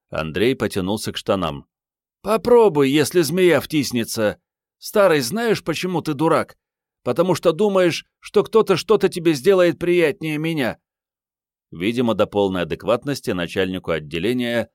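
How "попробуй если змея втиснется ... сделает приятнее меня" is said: put-on high, loud, challenging girl; teasing young woman. A narrator's reading. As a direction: taunt him, high and loud. (высокий громкий девичий голос, с вызовом)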